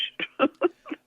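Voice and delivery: a short amused giggle; short laugh. Real, not acted. Laughing at the strange childhood.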